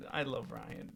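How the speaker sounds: ordinary talk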